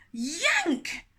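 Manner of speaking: punchy yank sound word; sudden yank. Acted. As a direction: snap the word out.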